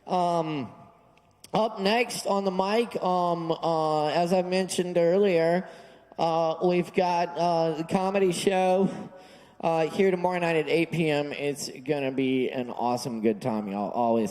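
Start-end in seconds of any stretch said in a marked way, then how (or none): none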